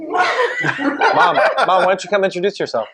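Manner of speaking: high, laughing call to mom. Real, not acted. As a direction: coax her in through laughter. Laughing, coaxing mom on camera.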